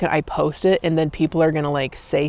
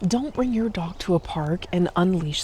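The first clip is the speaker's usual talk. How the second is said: whispering; 2 semitones higher, 6 dB quieter than usual. Real, not acted. Whispered aside about park.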